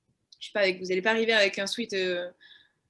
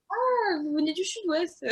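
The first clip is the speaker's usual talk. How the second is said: mock surprise; 7 semitones higher, slower than usual. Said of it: "Et là, c'était".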